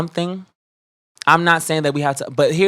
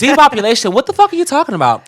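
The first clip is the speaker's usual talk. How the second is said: louder, higher, fast and disbelieving; exasperated outburst; 8 semitones higher, 5 dB louder, faster than usual. Real, not acted. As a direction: blast them for talking nonsense. Angry rant at commenters.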